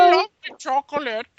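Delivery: put-on high, loud whiny child voice; greedy kid voice. Imitating the fat kid.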